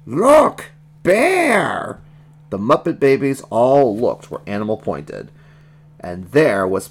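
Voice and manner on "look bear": high, loud monster cries; wild two-word roar; wild creature shout. Acted. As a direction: shout each word like an excited baby beast. Animal roars, jumping and pointing.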